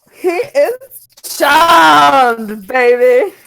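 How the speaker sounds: very slow, stretched gloating cry; triumphant shout. Real, not acted. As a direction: crow over the win. Triumphant spell success.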